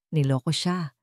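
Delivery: ordinary delivery, nothing marked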